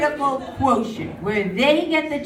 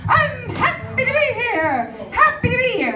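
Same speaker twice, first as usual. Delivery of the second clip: sing-song happy athlete; 11 semitones higher than usual. Retold or imitated. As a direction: croon it like a forced-cheerful Olympian. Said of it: "would you go".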